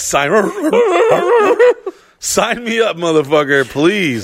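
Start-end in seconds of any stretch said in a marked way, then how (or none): 0.3-1.9 squealing laugh; high laughing burst. Real, not acted. Big laugh.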